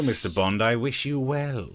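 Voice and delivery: slow, drawn-out mock villain farewell; Bond villain. Host echoing the comic's Bond villain, slowly menacing. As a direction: stretch it out like a film villain. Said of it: "it's more of a, "Mister Bond".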